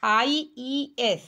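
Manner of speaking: I E S stretched very slowly; teacher stressing the ending by spelling it slowly. Shown as a demonstration. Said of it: "IES जोड़े हैं".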